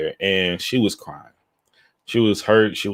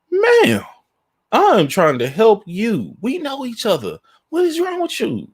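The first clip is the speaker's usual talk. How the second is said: much higher, pleading voice, half mocking; high pleading disbelief; slower than usual. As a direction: squeal out in shocked disbelief at her. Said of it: "I'm like".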